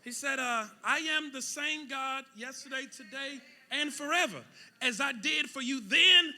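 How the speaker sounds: loud, high preaching; shouting sermon; full-force preaching. Acted. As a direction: preach it hard to the room.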